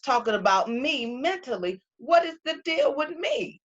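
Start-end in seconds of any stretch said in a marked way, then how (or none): 2.0-3.6 frustrated outcry. Real, not acted. Venting her frustration at herself.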